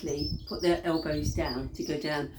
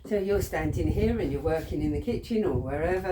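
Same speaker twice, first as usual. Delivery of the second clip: ordinary talk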